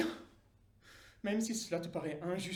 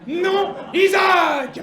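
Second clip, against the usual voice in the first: crié très fort et aigu; cri de refus; 10 semitones higher, 18 dB louder, much slower than usual. Acted. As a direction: coupe net le marchandage. Dieu refuse encore. (Dieu refuse, crié très fort)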